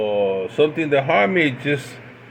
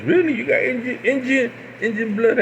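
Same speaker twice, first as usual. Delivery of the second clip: amazed; 9 semitones higher than usual. Retold or imitated. Friend amazed at Indian heritage.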